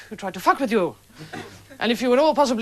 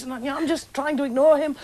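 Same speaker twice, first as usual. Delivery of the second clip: ordinary delivery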